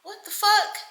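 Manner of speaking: high, startled cry of disbelief; teen sees a fight break out, shocked. Retold or imitated. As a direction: caught off guard mid-braid. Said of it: "I'm like".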